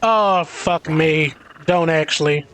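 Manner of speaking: ordinary delivery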